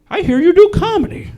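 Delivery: put-on high, loud old-lady voice; elderly woman. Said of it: "an elderly woman who was working there".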